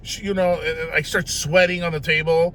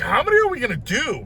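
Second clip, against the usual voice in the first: high, hurried mock panic; anxious question; 7 semitones higher, 3 dB louder, faster than usual. Patient asking nurse about more shots, anxious and hurried. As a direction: ask it fast and high, nervously.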